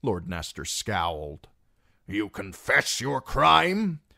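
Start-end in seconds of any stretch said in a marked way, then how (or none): 2.1-4.0 slow, loud, raised-pitch demand; stern accusation. A narrator's reading. Scowling lord questions the singer, stern and accusing. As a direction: challenge the prisoner, drawn out and forceful.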